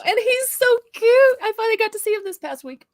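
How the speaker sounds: high, loud gush of delight. Real, not acted. Grandma telling viewers about seeing the baby, gushing. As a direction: squeal it like a besotted grandma.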